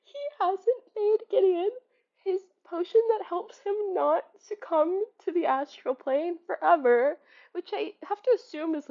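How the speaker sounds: high, rising outrage near tears; outraged disbelief. Real, not acted. Ranting about a forgetful character.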